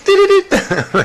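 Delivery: a burst of high, giddy laughter. Real, not acted. Ghost hunter laughing at what the box said, giddy high laugh.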